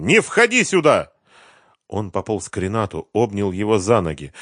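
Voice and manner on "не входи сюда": high, loud panicked shout; urgent high-pitched cry. A narrator's reading. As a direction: yell to keep someone out. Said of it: "закричал Семён".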